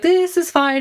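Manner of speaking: high, strained self-reassurance; forced calm. Retold or imitated. Gripping the pole, telling herself it's fine, high and strained. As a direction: squeak out that it's all fine. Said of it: "being like".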